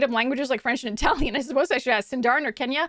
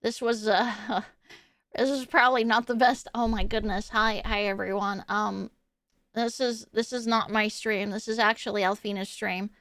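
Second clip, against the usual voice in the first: Ditzy; 2 semitones lower, 3 dB quieter than usual. Pretend. Nerd from the basement greeting the stream, awkward and soft. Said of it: "after I bring out Ditzy Nerd".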